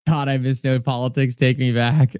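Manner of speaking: ordinary talk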